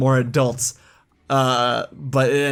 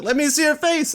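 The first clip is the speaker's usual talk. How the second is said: put-on much higher, squealing kid voice; mock childish delight at a prince; excited little girl; 13 semitones higher than usual. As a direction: gasp it out like a starstruck child. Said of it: "And Poppy's like".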